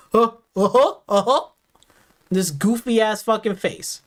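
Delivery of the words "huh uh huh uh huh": put-on voice, fake agreeable grunts; mocking fake nod. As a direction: mimic a goofy pretend face. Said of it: "He got a pretend face like".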